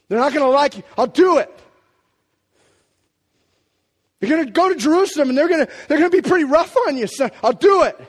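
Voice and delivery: lightly acted exchange, loud and high; warn, then answer with fierce resolve; Father and Son dialogue. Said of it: "And he goes".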